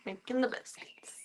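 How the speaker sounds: breathy unvoiced whisper to the cat. Real, not acted. Whispering to the kneading cat. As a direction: whisper it so she stays calm.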